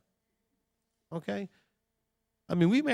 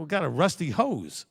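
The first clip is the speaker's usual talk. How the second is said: usual speaking voice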